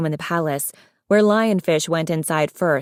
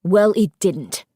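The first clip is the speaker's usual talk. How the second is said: slow, emphatic irritated retort; snapping; slower than usual. A narrator's reading. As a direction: shut the accusation down testily. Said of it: "Darkstalker snapped".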